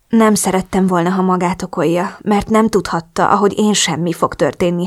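usual speaking voice

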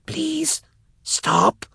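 very high, broken plea; weak high plea in pieces; spluttered. A narrator's reading. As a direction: splutter it out, begging. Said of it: "she spluttered".

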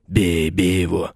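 slow, hoarse bellow from below; hoarse yell. A narrator's reading. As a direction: roar the order at the archer. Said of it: "хрипло заорал кто-то снизу".

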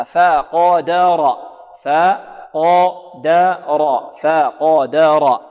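فقدر drawn out very slowly, light ف and heavy ق; correct فقدر. Shown as a demonstration. Teacher modelling correct فقدر letter by letter. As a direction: stretch each letter of فقدر carefully. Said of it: "الفاء هنا مرققة والقاف مفخمة".

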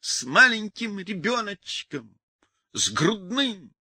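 wailing plaint. A narrator's reading. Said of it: "Людмила спрятала грудь и завыла".

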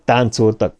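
usual speaking voice

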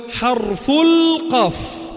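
slow emphatic القاف; teacher slowly stretching the letter name; drawn out. Shown as a demonstration. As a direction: say "حرف القاف" slowly, holding القاف. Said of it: "فوق شو قلت لك؟".

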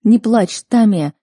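urgent soothing. A narrator's reading. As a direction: rush to console her. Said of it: "затараторила я, пытаясь успокоить её". (взволнованно успокаивает)

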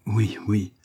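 a touch of pain held in; pained emotion. A narrator's reading. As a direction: answer with mild, painful emotion. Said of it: "avec une pénible émotion".

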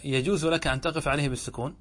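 ordinary talk

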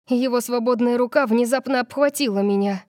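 usual speaking voice

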